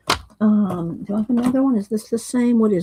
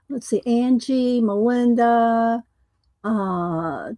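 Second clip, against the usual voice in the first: ordinary delivery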